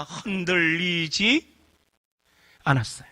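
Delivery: 흔들리지 drawn out very slowly; slow, weighted 흔들리지 않았어요; emphatic repeat. Shown as a demonstration. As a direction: stretch 흔들리지 slowly, then drop softly on 않았어요. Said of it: "다시 말합니다".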